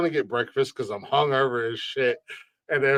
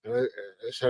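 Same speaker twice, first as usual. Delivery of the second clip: ordinary talk, nothing marked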